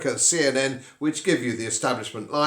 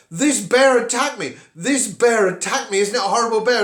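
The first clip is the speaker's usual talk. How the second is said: higher, louder indignant complaint; complaining victim; 8 semitones higher, 5 dB louder than usual. Acted. Bitten man complains to his friends, indignant and raised. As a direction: whine it to your friends like the wronged party. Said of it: "say to your friends".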